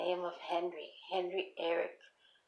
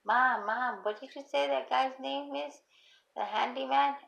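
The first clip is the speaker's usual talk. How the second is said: higher-pitched mock call from another room; retold yell; 6 semitones higher than usual. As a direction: imitate a daughter calling out to Mom, only half yelled. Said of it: "yelling from her room and saying".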